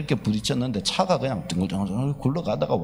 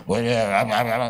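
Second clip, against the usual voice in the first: bulldog-like preacher voice; 4 semitones lower, slower than usual. Retold or imitated. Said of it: "불독 같아".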